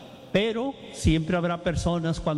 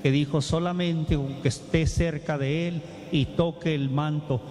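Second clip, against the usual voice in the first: tender altar call. Acted. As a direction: invite them forward gently, word by word. Altar call over music.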